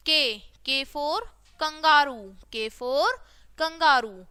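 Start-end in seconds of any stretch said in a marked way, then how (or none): none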